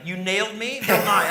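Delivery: high, loud, scandalized "you nailed me"; shocked gasp. Retold or imitated. [name] startled by the comic's joke, loud shocked voice. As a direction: act out a star caught off guard.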